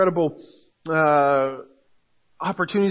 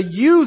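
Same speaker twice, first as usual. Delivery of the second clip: "you" hit higher and louder; stress on you; 5 semitones higher, 7 dB louder than usual. Shown as a demonstration. Pastor stressing the plural "you" to his congregation. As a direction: punch the word "you". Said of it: "is plural".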